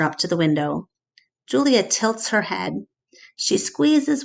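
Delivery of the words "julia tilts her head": plain reading voice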